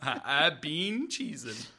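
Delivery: slow, laughing repeat of the punchline; laughing through it. Acted. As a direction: giggle the line out again.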